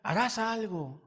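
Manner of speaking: súplica alta y lenta a Dios; oración fervorosa. Acted. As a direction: ruega con fuerza, despacio. Predicador ruega a Dios. (predicador orando con fervor)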